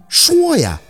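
sharp, high impatient outburst; agitated demand. A narrator's reading. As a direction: demand an answer, pitched right up. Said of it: "宝四还急了". (着急地高声逼问)

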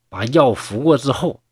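plain reading voice

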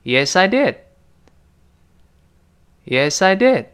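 Yes, I did with the final d swallowed; 跟读 Yes, I did，did 尾音失去爆破; model reading. Shown as a demonstration. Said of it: "我们跟老师再读一遍". (示范 did 失爆)

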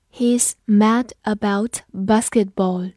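slow, word-by-word model reading; slow read-through. Shown as a demonstration. Teacher models an English sentence for learners, slow read-through. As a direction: read it slowly with clear gaps between words. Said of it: "我们慢读一遍".

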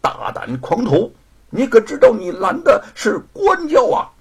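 loud, high, officious scolding; indignant official's rebuke. A narrator's reading. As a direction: berate him, rising to a high shout. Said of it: "呵斥着说".

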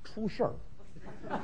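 very low, barely audible confiding; conspiratorial hush; hushed secret. Acted. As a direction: drop right down as if leaking bad news. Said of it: "大点声说".

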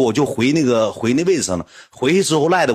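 usual speaking voice

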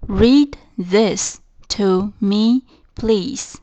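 each word of Read this to me, please spaced out slowly; slow model reading. Shown as a demonstration. Teacher repeating the sentence for students, very slow and clear. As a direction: spell it out slowly for learners. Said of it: "再重复一遍这个句子".

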